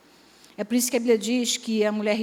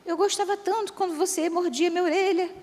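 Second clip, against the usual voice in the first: put-on much higher, doting little old lady voice; sweet old lady; 8 semitones higher than usual. Elderly wife sweetly asks her husband for old affection, high doting voice. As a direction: coo it like a frail granny missing old romance. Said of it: "a velhinha fala assim pra ele".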